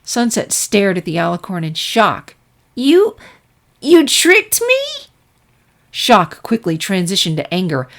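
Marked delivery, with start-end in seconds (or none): 2.7-5.1 put-on high, slow incredulous child voice; stunned disbelief. Filly realizes her teacher tricked her, high slow disbelief. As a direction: let the betrayal sink in.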